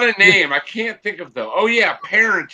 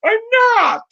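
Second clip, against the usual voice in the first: protesting reluctant messiah. Pretend. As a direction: wail it like a reluctant prophet denying the crowd. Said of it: "followed around by a bunch of people and saying".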